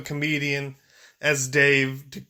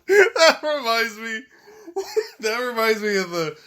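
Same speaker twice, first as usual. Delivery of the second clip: real laughter breaking up the words; higher, helpless with laughter; laughing hard; 6 semitones higher than usual. Real, not acted. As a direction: struggle to talk through laughter. Cracking up after the clip.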